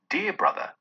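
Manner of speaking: put-on high, softened child voice; little girl. A narrator's reading. Child come to bring her brother home, high and affectionate. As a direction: say it as a fond small sister. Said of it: "said the child".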